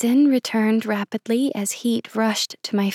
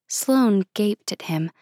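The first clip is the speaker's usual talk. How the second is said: usual speaking voice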